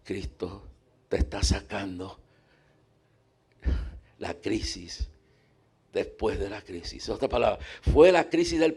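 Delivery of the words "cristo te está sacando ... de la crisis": put-on preacher hugging weeping old woman, low and soft; gentle comfort. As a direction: soothe her gently in a hug. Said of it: "Y le dije".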